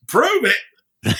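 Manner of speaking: high, incredulous echo; mock disbelief. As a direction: toss it back high as if it's absurd. Rob echoing in disbelief.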